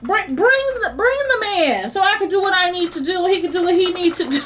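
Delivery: high, fast pleading to God; fast, high exasperated begging; exasperated plea. Retold or imitated. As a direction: hurry him up, half-joking. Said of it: "it just feels like".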